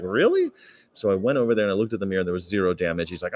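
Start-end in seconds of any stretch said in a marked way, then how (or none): 0.0-0.5 high rising disbelief; shocked high "Really?"; incredulous really. Retold or imitated. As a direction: squeak it out in disbelief. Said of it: "and I was like".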